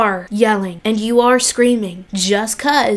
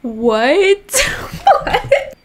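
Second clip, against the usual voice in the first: laughing disbelief; 6 semitones higher than usual. Real, not acted.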